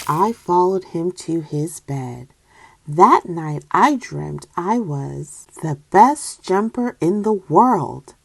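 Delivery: usual speaking voice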